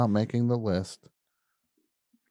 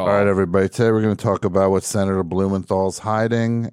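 political attack ad; 8 dB louder, faster than usual. Pretend. Mock video intro to viewers.

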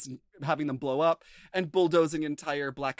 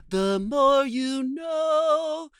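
slow, higher, sung-out slogan; announcer slogan; 9 semitones higher, 6 dB louder, much slower than usual. Retold or imitated. Quoting the PSA tag, a slow announcer slogan. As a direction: draw it out like a TV jingle.

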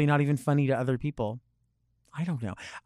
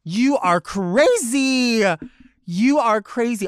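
high, loud mock scolding; hammed-up can't-believe-you exclaiming; fake hilarity; 8 semitones higher, 6 dB louder, much slower than usual. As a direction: squeal it with phony delight. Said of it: "That's that fakeness".

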